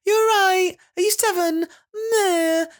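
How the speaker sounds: put-on very high babying voice to an older child. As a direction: talk down to a seven-year-old in squeaky baby talk. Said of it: "haven't quite grown out of the".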